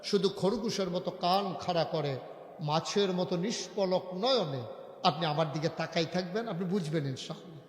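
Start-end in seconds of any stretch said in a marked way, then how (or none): none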